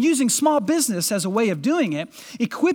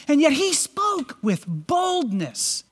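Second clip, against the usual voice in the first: bold proclamation; 5 semitones higher, slower than usual. Acted. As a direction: proclaim it like a preacher at full force. Preacher's climax on boldness.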